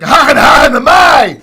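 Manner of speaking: loud, high, harsh mock Arabic shouting. Mock Arabic shouting, guy's speech sounding aggressive to outsiders. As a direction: bark out fake Arabic syllables to mock how guys sound. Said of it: "but when a guy says, like".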